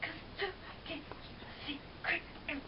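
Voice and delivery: soft unvoiced whisper. Real, not acted. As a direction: whisper it like a secret. Whispered line.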